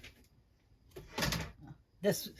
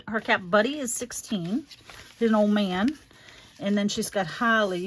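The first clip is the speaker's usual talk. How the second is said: usual speaking voice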